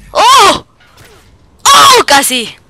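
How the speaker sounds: high, loud yelps of surprise; surprised shout. Real, not acted. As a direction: yelp at the near miss. Near miss in game.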